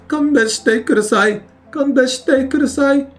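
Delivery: high-pitched pleading game enemy; begging forgiveness. Acted. Teacher acts out a begged "please forgive me", high pleading voice. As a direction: plead for mercy in a high put-on voice. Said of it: "You defeat the enemy and they say".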